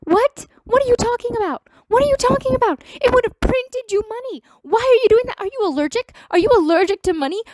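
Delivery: real shock spilling out at the studio; incredulous outburst. Real, not acted. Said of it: "made me go completely bonkers crazy".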